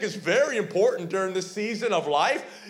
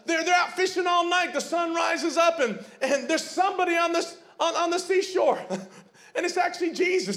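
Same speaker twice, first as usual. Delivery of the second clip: fervent preaching; 9 semitones higher than usual. Acted. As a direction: preach the scene at full voice. Preaching the story, shouting.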